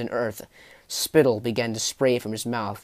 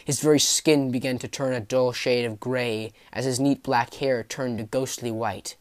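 ordinary delivery, nothing marked

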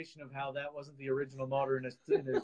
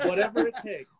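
laughing; 6 semitones higher, 7 dB louder than usual. Real, not acted.

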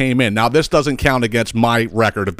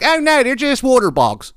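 mocking voice; 12 semitones higher than usual. Mocking people who say water bugs.

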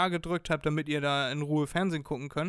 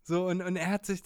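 usual speaking voice

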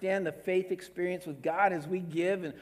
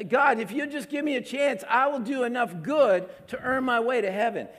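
higher, louder, voicing a believer who bargains with God; pleading bargainer; 7 semitones higher, 6 dB louder than usual. Preacher voicing a believer bargaining with God, mock pleading. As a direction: plead for one more chance. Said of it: "Some of us even have this perspective".

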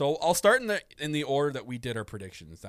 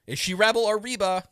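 higher, louder puzzled call; mock-bewildered fan; confused outburst; 7 semitones higher, 7 dB louder than usual. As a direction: ask it baffled, half-laughing. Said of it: "So I'm like".

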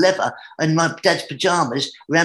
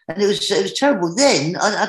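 ordinary talk, nothing marked

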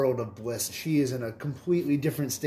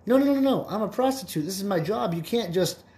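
higher, upset protest; indignant prostitute; 6 semitones higher than usual. Acted. Prostitute insists the koala must pay her, higher and indignant. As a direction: plead your case, pitch climbing.

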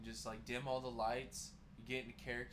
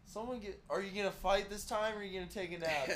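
mock taunting; 7 semitones higher, 6 dB louder than usual. Teasing old man character.

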